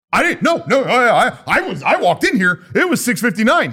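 flustered self. Retold or imitated. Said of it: "that kept, like".

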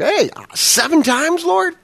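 proud, high, slow question fishing for praise; eager big dog. Retold or imitated. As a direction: show off like expecting an attaboy. Peter eager to impress Jesus.

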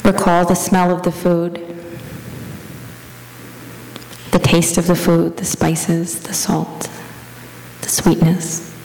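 even, level prayer-meditation voice with long pauses; guided meditation. Real, not acted. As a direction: guide them gently into the imagined scene, leaving space between phrases.

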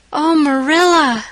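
a stricken cry as hope is lost; gasped. A narrator's reading. Anne pleads as Marilla shuts the door, a slow gasping cry. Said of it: "gasped Anne".

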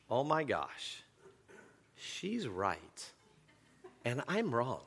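soft inner realisation, half to himself; hushed voice in his head admitting defeat; quiet inner voice. Retold or imitated. Pastor voicing his inner thought mid-argument with wife, hushed. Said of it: "I will hear this voice in my head".

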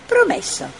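high, quick little creature promise with a wink; cheeky promise. A narrator's reading. Puzzle promising Mira with a wink, high and playful. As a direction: toss it back playfully. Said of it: "ammiccò Puzzle".

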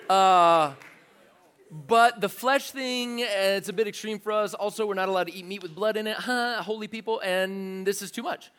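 a hammed-up squeamish crowd. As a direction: recoil from the idea with nervous disgust. Voicing crowd, much louder and higher.

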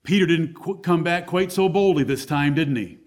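ordinary delivery

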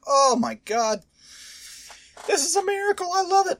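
giddy high-pitched delight; overjoyed. Real, not acted. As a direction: squeal it like a fan's dream came true. Browns routing the Steelers.

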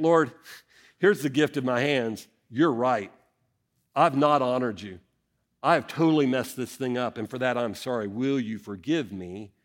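sincere confession. Acted. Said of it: "if you take it as a legitimate offer".

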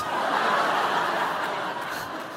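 soft unvoiced chuckle; breathy laugh. Real, not acted. Pastor laughing while telling congregation the story, soft chuckle. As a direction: laugh quietly at the memory.

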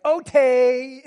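put-on high, loud little-kid "okay"; toddler voice. Grandpa imitating his toddler grandson's "okay". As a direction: squeal it like a two-year-old. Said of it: "Or am I just saying, like".

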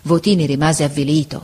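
ordinary delivery, nothing marked

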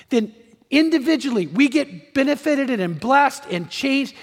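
high, loud pulpit voice; impassioned preaching. Acted. Pastor challenging the church about the world today, impassioned.

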